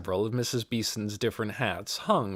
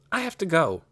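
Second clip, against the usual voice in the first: mildly impatient, pitched higher; a touch of hurry to end the call; 6 semitones higher, faster than usual. A narrator's reading. Girl cutting short a phone call, mildly impatient. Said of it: "Amanda sounded impatient".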